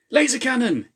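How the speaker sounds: very high, loud warning scream. A narrator's reading. Ewan screaming a warning to his team, very high and loud. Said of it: "he screamed".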